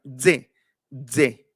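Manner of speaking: "D" forced into "dze"; demonstrated dze, dze; D with a little Z. Shown as a demonstration. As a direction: hit the D so a Z buzzes out. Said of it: "hace que salga con la zetita".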